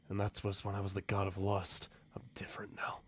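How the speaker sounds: whispered, fading away; quiet confession to an old lover; hushed whisper. Acted. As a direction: whisper it as if ashamed. Sadist denies his past.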